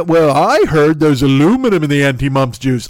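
know-it-all skeptic voice; loud smug doubter; mock skeptic. Mocking skeptic quote.

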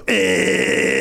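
drawn-out mechanical buzz; imitated wind-up toy. Host imitates a wind-up toy, mechanical buzz. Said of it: "wind-up toys that go".

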